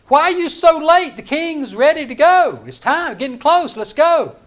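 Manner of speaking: loud, high-pitched, impatient summons; urgent shout. Acted. King's servant at Haman's door hurrying him, urgent shouting. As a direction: holler at someone to hurry up.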